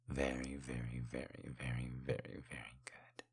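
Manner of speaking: very soft, low, trailing murmur; hushed, low relish; savoring hush. Acted. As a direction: repeat it like a fading purr. Savoring pleasure in intimate roleplay.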